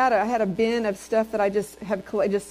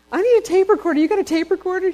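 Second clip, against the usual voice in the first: much higher and louder, excitedly asking; eager request; 9 semitones higher, 7 dB louder than usual. Retold or imitated. Preacher calling her mom for a tape recorder, eager and much louder. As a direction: urgently ask mom for the gadget. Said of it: "I said".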